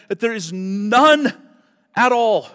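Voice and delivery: none drawn out slowly and loud; emphasize that there is none at all; stress on none. Shown as a demonstration. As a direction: lean hard on none. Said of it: "The no is emphatic".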